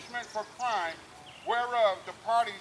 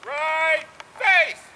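drill command shout; 6 semitones higher, much slower than usual. Acted. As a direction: call it out like a drill sergeant.

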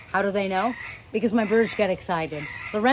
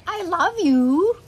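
higher, louder, slow squawky parrot I love you; 10 semitones higher, 5 dB louder, slower than usual. Retold or imitated. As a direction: mimic a pet Amazon parrot saying it. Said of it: "in her Amazon parrot voice".

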